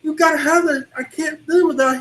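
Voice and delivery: very high, loud mock panic; acted panic attack. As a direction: imitate someone frantic over a lost lifeline. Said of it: "it's about to have a panic attack".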